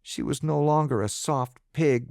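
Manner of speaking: usual speaking voice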